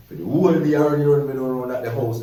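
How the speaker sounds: loud, high, thrilled recounting; excited loud telling. Real, not acted. Telling a friend how he ran from a beating, loud and excited. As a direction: belt it out like the chase is happening now.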